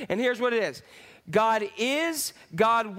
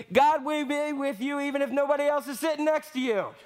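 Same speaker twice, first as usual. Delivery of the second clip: playful yell; 5 semitones higher than usual. Shown as a demonstration. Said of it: "yell at them and say".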